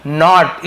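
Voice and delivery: "नॉट" with an open aw vowel; teacher sounding out "नॉट" with aw; pronunciation demo. Shown as a demonstration. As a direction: model "नॉट" with a rounded aw, firmly. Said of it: "इसे नोट नहीं पढ़ रहा हूँ".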